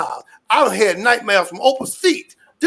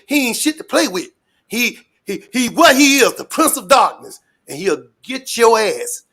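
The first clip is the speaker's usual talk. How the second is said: ordinary delivery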